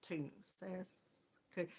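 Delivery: breathy whisper, just the first syllable Sask; mouthing the start of the word to herself. Real, not acted. Host quietly practising a viewer's city name, whispered.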